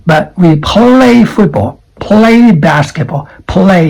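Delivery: aspirated play with a crisp L; clear L in play. Shown as a demonstration. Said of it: "You can feel the L sound".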